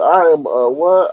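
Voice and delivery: ordinary talk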